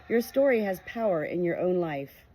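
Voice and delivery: plain reading voice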